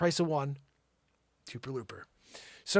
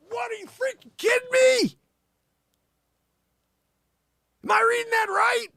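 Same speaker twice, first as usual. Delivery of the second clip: very high-pitched disbelief; incredulous outburst; 3 dB louder than usual. Acted. Host reacting to star's earnings, high incredulous shout. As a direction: shriek it as if you can't believe the figure. Shocked at the money figure.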